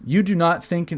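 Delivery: high-pitched, emphatic declaiming; mock all-caps outburst; all caps reading. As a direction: read it as if every word is capitalised. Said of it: "I like reading in all caps".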